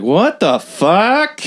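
slow, high-pitched imitated disbelief; drawn-out shock. Projectionist just stabbed in his car, drawn-out shock. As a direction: stretch it out, pitch jumping up.

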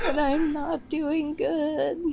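slow, weak, half-whining; drained and sorry for herself; hungover. Real, not acted. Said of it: "I'm so hungover today".

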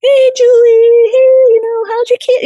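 very high, loud, drawn-out hello; bubbly greeting. Retold or imitated. Surgeon greeting Julie in the O R, bubbly. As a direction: imitate a bubbly friend calling out merrily. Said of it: "she was friendly and she was bubbly".

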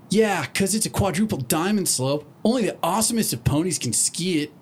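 high, cocky tomboy pony; brash Rainbow Dash. Acted. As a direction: boast like a show-off pony.

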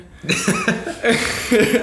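high laughing fit. Real, not acted. Laughing at his mom's blunt reply, high loud laugh. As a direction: laugh hard at the memory.

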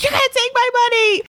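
squeaky high mock eager voice; high-pitched mock eagerness, thrusting the money; eager quote. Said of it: "He's like".

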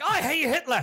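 high-pitched mock German blurt; German actor outburst. Actor being put in the costume, mock German outburst. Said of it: "He's like".

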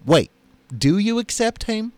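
a touch of Mississippi surprise; Mississippi accent. Acted. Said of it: "how's my Mississippi accent".

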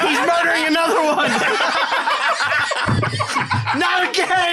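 high giggly joking through laughter; laughing. Real, not acted. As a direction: crack up while joking. Group cracking up after scream.

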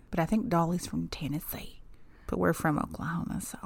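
an aside to the co-host, whispered. Real, not acted. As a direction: drop to a secret whisper. Whispered aside about Dolly.

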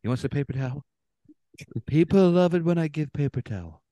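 self-pleased politician impression; Trump. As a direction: put on a boastful president's voice. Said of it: "segueing to Trump".

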